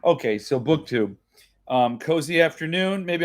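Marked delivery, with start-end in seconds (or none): none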